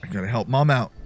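ordinary delivery

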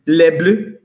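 playful mock French accent; French accent, just for a laugh; silly French accent. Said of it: "doing my silly French bits".

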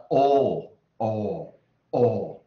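"哦" loud and held long, repeated with no R; long vowel without R. Shown as a demonstration. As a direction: repeat a long open "哦", never curling the tongue. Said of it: "不要把R写出来哈". (英式长元音 "哦"，不卷舌)

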